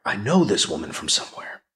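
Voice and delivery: breathy whispered aside; whispered thought. A narrator's reading. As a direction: say it under your breath. Inner thought, muttered.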